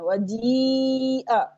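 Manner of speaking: very drawn-out jee in "वजीअ"; jeem joined to ya. Shown as a demonstration. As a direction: hold the ee of "वजीअ" long, then a short a. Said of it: "जीम को या से मिला रहे हैं".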